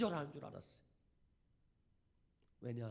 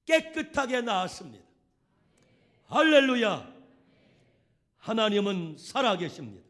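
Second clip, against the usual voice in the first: shouted hallelujah, much louder and higher; preaching at full force; 5 semitones higher, 19 dB louder than usual. Acted. Preacher shouting triumphant praise to the congregation. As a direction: proclaim it to the whole church.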